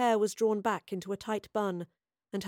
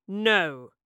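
firm, louder officer's refusal; 5 dB louder than usual. A narrator's reading. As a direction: shut it down with authority. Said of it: "said the senior officer firmly".

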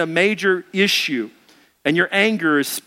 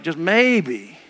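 low, muttered plea to nuke them; mock-sinister lower voice; Jonah's grim wish; 7 semitones lower, slower than usual. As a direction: Jonah hoping God destroys the city, low and dark. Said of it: "But Jonah's like".

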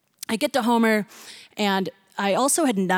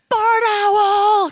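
much higher, louder "Barn owl!"; shouted guess; 11 semitones higher, 6 dB louder than usual. Retold or imitated. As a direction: call it out from the crowd.

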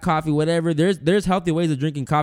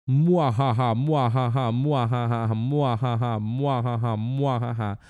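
evil laugh; 4 semitones lower than usual. Pretend.

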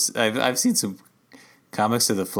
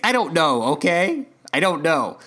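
defensive; 6 semitones higher, 4 dB louder than usual. Retold or imitated. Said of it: "And he's like".

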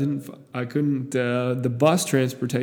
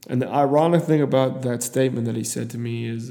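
ordinary talk

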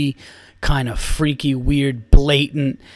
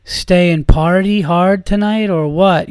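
mock European accent, lightly raised in pitch; imitating foreign woman; 3 semitones higher, 4 dB louder, slower than usual. Comic retelling a tipsy woman's come-on, imitated foreign accent. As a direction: put on a hint of a drawn-out foreign accent. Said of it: "that's the voice that I have".

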